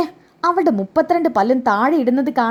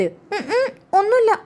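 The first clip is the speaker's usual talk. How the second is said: much higher giggling denial; nervous giggle; 9 semitones higher, much slower than usual. Acted. Caught glaring at the grandmother, she giggles a much higher denial. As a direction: laugh it off, high and sheepish.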